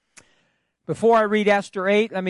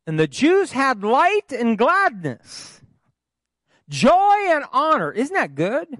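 jubilant preaching; 9 semitones higher, 5 dB louder than usual. Acted. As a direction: lift the room with each joy. Rising scripture exultation.

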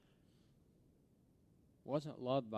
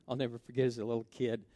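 ordinary talk

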